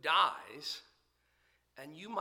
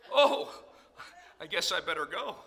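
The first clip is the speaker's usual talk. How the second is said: sheepish laugh; 7 dB louder than usual. Retold or imitated. Driver realising the light is green, laughing. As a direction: laugh at yourself.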